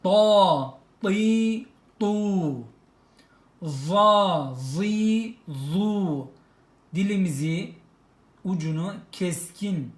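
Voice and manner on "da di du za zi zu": "Da, di, du" with heavy emphatic d; teacher drilling emphatic syllables. Shown as a demonstration. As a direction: sound out "Za, zi, zu" thick and clear. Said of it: "dat harfi".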